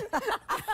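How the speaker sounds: high peal of laughter; real laugh. Real, not acted. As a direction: crack up at the joke. Presenters crack up together.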